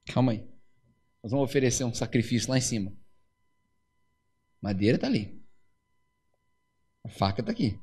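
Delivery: a hint of a quiet, evasive dad; hushed. Abraão explains quietly to Isaac. (pai desconversando com o filho, voz baixa e grave)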